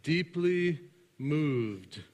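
"deeply moved" drawn out very slowly, each word weighed; read slowly. Shown as a demonstration. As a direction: stretch out "deeply moved", slow and deliberate. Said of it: "is too weak of a translation".

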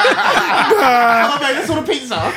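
high laughing fit around the words; loud laughing. Real, not acted. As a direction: crack up at your own joke. Joke lands, loud laughter.

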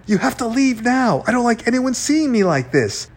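high-pitched anxious pleading; rattled. A narrator's reading. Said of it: "Z was getting shaky".

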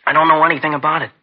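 angry outburst. Acted. Accused boy shouting his denial at detectives, exasperated and loud. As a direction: burst out, fed up with the accusation.

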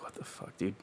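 whispered disbelief under the breath; muttering to himself, caught by the cop, whispered; stunned whisper. Retold or imitated. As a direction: breathe it out in shock. Retelling shock at DUI threat.

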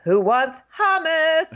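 slow, high singsong call; mock cheery offer. Imitating a contestant offering everyone food, exaggerated singsong call.

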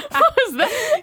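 squealing with laughter, high; laughing. Real, not acted. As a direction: laugh through the words. Laughing at the baby voice.

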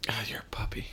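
soft teasing whisper. Real, not acted. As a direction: breathe it as a joking aside. Teasing aside to cohost.

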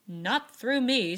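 loud, slow, firm refusal; decidedly. A narrator's reading. Joe refuses Laurie to Beth, decided and firm. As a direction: shut the idea down firmly. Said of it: "said Joe decidedly".

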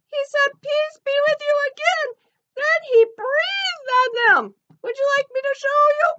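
high, squeaky cartoon elephant. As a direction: chirp it like a little elephant puppet. Ellie retells the story.

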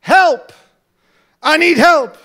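loud desperate cry for help; begging shout. Acted. Blind beggar calling out by the road, loud cry. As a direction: yell for help from the roadside. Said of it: "he's on the road begging".

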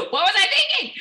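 high, loud laughing exclamation; incredulous at yourself, voice shooting up; laughing regret. Real, not acted. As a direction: cry it out through laughter. Laughing at her own regret.

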